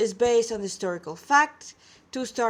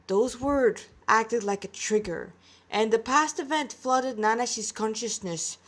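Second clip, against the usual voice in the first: ordinary delivery, nothing marked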